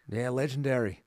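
put-on legendary in a light Australian accent. To his cohost, legendary in an Aussie accent. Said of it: "That was very good".

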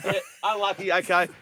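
casual newsroom reply; newsroom colleague voice. Retold or imitated. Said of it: "imagine them putting the auto cue down".

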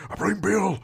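high, strained put-on impression; mock Bill voice. Host mocking the actor's overwrought part, high strained voice.

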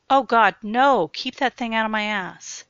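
loud, desperate outcry; panicked protest. Acted. Jared, tied down, crying out in panic at Sue. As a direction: yell it in panic.

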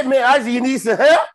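hammed-up friendly neighbor; imitating the neighbor. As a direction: play the helpful buddy, loud and bright. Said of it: "He said".

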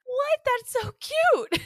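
high, loud, laughing delight; delighted squeal. Real, not acted. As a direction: squeal at something adorable. Delighted by cute name.

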